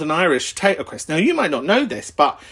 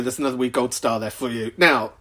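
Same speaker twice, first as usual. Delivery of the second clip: ordinary delivery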